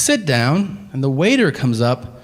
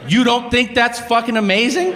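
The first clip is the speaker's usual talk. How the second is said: high-pitched incredulous demand; exasperated disbelief; 8 semitones higher than usual. Retold or imitated. Diner to unimpressed waiter, exasperated high question.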